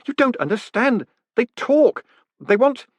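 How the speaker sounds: nearly hysterical, begging to be believed; frantic. A narrator's reading. Moist insisting to the golem the letters talk, frantic voice.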